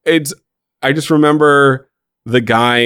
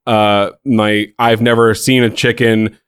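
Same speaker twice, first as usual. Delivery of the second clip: ordinary delivery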